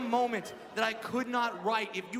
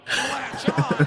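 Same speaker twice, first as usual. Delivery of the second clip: laughing emphasis; 9 dB louder than usual. Real, not acted. Repeated with a loud laugh.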